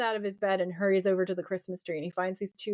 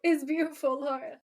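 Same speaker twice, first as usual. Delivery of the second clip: gushing; 6 semitones higher than usual. Real, not acted. Gushing to co-host over the childhood flashback, high and cooing.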